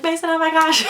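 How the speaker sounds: high, loud "oh my gosh"; excited squeal. Retold or imitated. Coworker squealing back to Mason over the yarn, high and loud. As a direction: squeal back at your friend.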